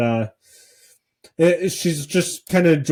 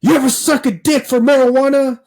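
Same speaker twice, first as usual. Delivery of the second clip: high, loud comic movie-quote voice; movie guy imitation; 11 semitones higher, 5 dB louder than usual. Host quoting a Half Baked joke, high loud imitation. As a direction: belt the line out high like a hammed-up film character. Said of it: "where the guy's like".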